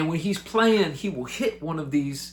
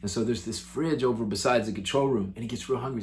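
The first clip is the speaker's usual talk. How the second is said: ordinary delivery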